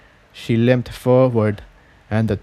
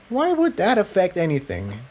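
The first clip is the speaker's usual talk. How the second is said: querying; 7 semitones higher, 4 dB quieter than usual. Acted. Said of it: "Tiptoe queried".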